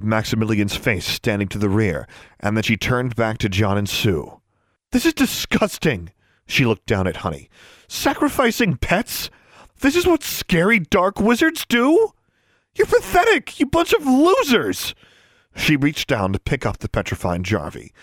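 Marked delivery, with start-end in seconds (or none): 4.9-6.1 high-pitched outraged disgust; disgusted outburst. A narrator's reading. Furious girl confronts the society. 7.9-14.9 high-pitched, contemptuous tirade; scornful outrage. A narrator's reading. Furious girl berates the society.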